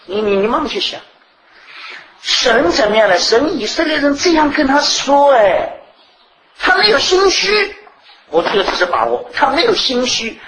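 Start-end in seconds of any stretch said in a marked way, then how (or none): 3.2-10.4 higher, slowed voice of God answering Israel; voicing God. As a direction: speak as God, patient and sure. Preacher voices God's reply. (高声缓慢地扮演神的口吻)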